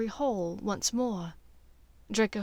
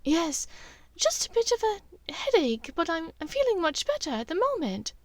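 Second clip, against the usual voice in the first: higher woman's voice, a little hesitant; Narcissa voice; 8 semitones higher than usual. Acted. Draco as Narcissa, answering Bellatrix in a higher woman's voice. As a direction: fake composure in a higher voice.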